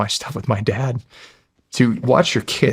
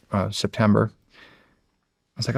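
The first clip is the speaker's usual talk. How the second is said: ordinary talk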